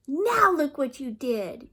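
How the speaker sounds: put-on girl yelling at baby over her castle; yelled. A narrator's reading. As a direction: yell it at the baby sister. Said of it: "yelled D W".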